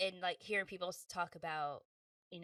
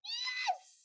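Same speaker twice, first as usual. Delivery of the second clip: very high excited shouts; celebrating wildly; ecstatic yes; 5 dB louder than usual. Retold or imitated. Inner celebration after booking, very high shouted yes. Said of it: "On the inside, I was just like".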